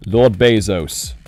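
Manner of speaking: normal talking voice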